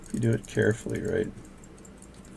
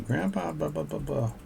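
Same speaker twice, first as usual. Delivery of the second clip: higher, lightly childish imitation; imitating granddaughter; 5 semitones higher, 4 dB quieter than usual. Granddaughter interrupting grandpa at work, mock child voice. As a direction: pipe it up like a little girl calling. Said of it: "coming up and going".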